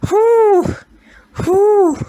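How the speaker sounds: two loud, high whoops of breath; high whew. Real, not acted. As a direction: blow out a high "whew" to recover. Composing self after crying.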